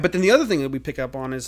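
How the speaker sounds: usual speaking voice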